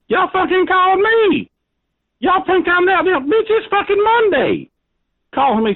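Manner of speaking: high-pitched outraged yell; angry yell. Real, not acted. Angry rant at prank caller.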